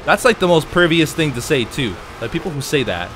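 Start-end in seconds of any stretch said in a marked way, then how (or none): none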